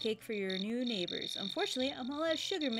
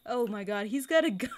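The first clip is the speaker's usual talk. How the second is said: ordinary talk, nothing marked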